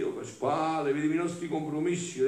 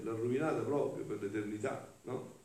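ordinary talk

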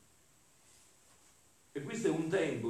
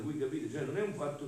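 usual speaking voice

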